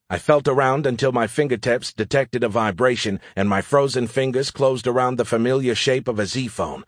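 plain reading voice

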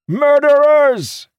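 very high, long, loud yell; anguished shout. A narrator's reading. Captain held back by soldiers, shouting. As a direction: scream the accusation, drawing it out. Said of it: "he shouted".

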